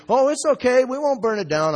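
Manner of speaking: high, loud, eager protest; mock pleading reassurance. Potter talking pastor into allowing a kiln, high pleading.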